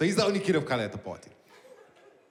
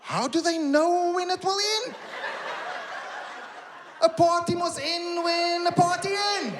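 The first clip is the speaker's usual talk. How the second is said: incredulous; 10 semitones higher, slower than usual. Real, not acted. Incredulous rant at party end times.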